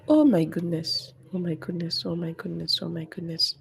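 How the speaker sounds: muttered under breath, dismayed; quiet repeated groan of dismay; dismayed muttering. Real, not acted. Dismayed at crochet mistake.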